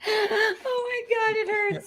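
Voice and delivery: high, breathless laughter through the words; can barely speak for laughing; laughing so hard it hurts. Real, not acted.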